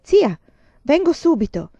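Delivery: loud, high urgent cry; urgent call. A narrator's reading. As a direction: shout it down the phone before hanging up. Nephew urgently calling aunt. (grido urgente, forte e acuto)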